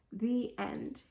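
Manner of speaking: plain reading voice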